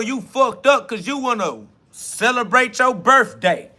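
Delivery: real exasperated tirade at doubters; heated rant. Real, not acted. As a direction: hammer the message home, fired up. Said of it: "you think that my delivery is anger".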